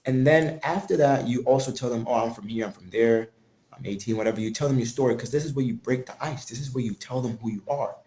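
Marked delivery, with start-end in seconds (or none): none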